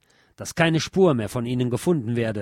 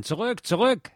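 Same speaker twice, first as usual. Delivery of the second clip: a mild mock shout, pitched higher; soldiers shouting; 9 semitones higher than usual. A narrator's reading. Soldiers yelling at the advancing crowd, a lightly raised shout. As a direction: call it out like a soldier ordering the crowd back. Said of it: "Vergebens schreien die Soldaten".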